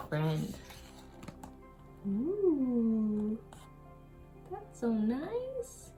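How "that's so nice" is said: plain reading voice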